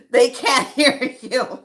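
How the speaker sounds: slow, laughing through the words. Real, not acted. Laughing while telling viewers they can't hear you. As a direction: let the laugh carry it.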